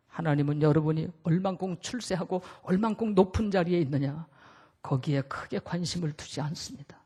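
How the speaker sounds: quiet preaching. Acted. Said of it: "preacher drops voice low".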